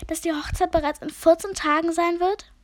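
high, loud calling out; spider calling out high. Acted. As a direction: call it out sharply from the web. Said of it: "rief Fatima Fadenkreuz".

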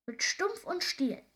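high, slow exuberant call; gleeful creature calling over the wind. A narrator's reading. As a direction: shout it out high, stretching each word. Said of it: "rief das Männchen".

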